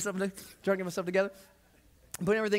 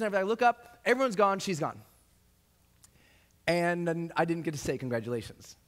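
ordinary talk, nothing marked

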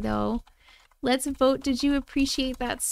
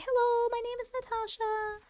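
high little cat voice speaking for her; voicing the cat; 12 semitones higher, 8 dB quieter than usual. Streamer speaking for her cat Natasha, high cutesy voice.

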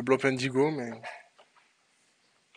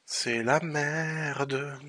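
drawn out; 9 dB quieter, much slower than usual. Real, not acted. Dragged-out despair.

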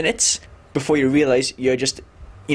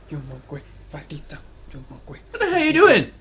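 exasperated; much slower than usual. Acted. Exasperated at silly character.

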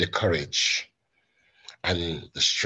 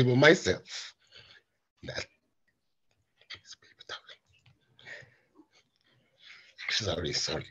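choked up. Real, not acted. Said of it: "I get very emotional".

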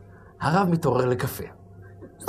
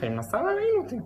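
insistent whine; 12 semitones higher, 4 dB quieter, faster than usual. Acted. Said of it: "אני אומר לך".

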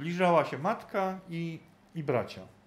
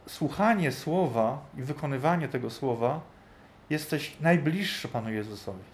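normal talking voice

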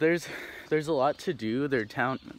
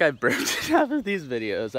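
laughing; 4 semitones higher, 4 dB louder than usual. Real, not acted. Laughing about burping on camera.

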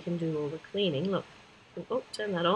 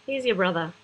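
ordinary talk

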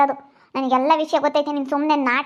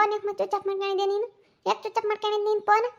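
high accusing question; 6 semitones higher, 4 dB quieter than usual. Acted. Wife accuses him of switching phone off.

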